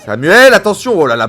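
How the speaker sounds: loud high shout of alarm; excited sports commentary. Real, not acted. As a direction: yell the warning mid-play. Live chance on goal.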